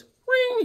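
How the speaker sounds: very high trilled ring; imitated phone ring. Caller waiting for voicemail, high imitated ring. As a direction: mimic a telephone ringing, high. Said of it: "it goes, it goes".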